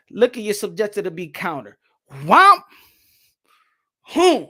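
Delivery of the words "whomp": loud high whomp; punch impact. Shown as a demonstration. Streamer showing a counter landing, loud whomp. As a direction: smack the impact sound out.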